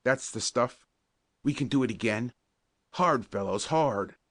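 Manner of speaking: a touch of strained hope; hopeful urging. A narrator's reading. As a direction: urge the team on, lightly acted. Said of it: "a sudden note of hope in his strained voice".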